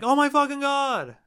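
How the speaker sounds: hammed-up disbelief at a memory; shocked realization. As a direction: act out suddenly remembering something horrifying. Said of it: "have you been like".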